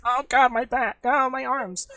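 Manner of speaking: high, loud, hammy yelps of pain; pained whining. Acted. Prisoner being hauled up by captor, pained high wailing. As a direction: yelp and complain as you're dragged up.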